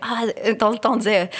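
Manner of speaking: ordinary talk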